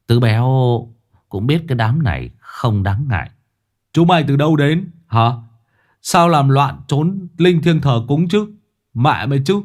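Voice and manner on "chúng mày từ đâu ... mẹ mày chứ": scolding ghosts. Acted. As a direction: bark at the ghosts like an angry master. (thầy Tứ quát mắng lũ ma quỷ, giọng to và giận)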